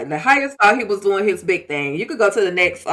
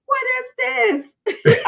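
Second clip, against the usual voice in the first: very high, drawn-out attempt at an accent; badly faked accent, slow and high; Elder Mother; 14 semitones higher, much slower than usual. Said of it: "I can't do the accent".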